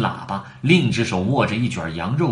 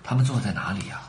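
ordinary delivery

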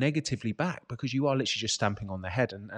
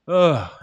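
mock groan; 4 semitones higher, 10 dB louder than usual. Groan about lockdown misery.

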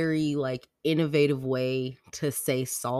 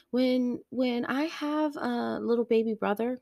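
high-pitched child imitation; little kid asking; 11 semitones higher than usual. Daughter asking about a future baby brother, childlike. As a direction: start a question in a high kid voice.

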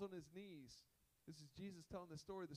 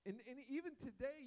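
ordinary delivery

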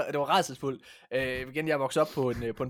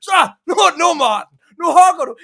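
high, loud, fast mock shouted order; grandmother shouting; 12 semitones higher, 13 dB louder, much faster than usual. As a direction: bark the command like a fed-up grandma. Adults ordering boy to chop.

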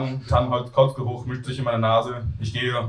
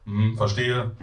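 plain reading voice

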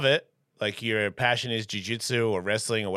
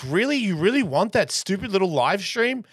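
mock disbelief; 9 semitones higher than usual. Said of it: "I'm like".

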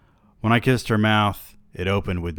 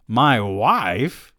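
incredulous; 5 semitones higher, 6 dB louder than usual. Acted. Reacting to mention of his wife.

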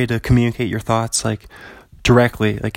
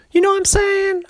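very high squeaky repeat of the question; silly falsetto. Pretend. Podcaster repeating his catchphrase in a squeaky falsetto. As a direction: toss the line up into a goofy falsetto.

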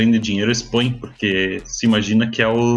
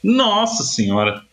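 exasperated exclamation; 9 semitones higher, slower than usual. Real, not acted. Exasperated exclamation about bugs.

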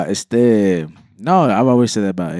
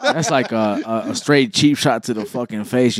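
ordinary talk

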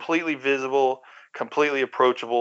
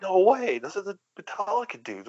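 higher, starstruck disbelief; mock disbelief; 7 semitones higher, 3 dB quieter than usual. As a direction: imitate stunned fans catching their idols misbehaving. Acts out startled fans' reaction.

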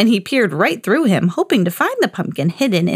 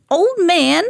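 put-on high-pitched old woman calling her husband; old woman's high voice; 9 semitones higher than usual. A narrator's reading. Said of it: "said the old woman".

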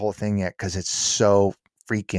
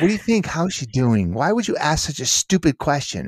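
a mock inner critic telling off the speaker; scornful inner voice; 7 semitones higher than usual. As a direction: berate yourself in your own head, mock disgust. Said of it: "the immediate thought that goes through my head".

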